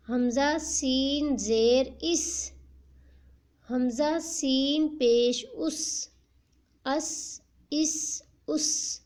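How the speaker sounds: put-on slow, deliberate spelling-out for children; slow syllable drill. Shown as a demonstration. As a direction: spell each sound out slowly for a young learner. Teacher drills Qaida syllables slowly.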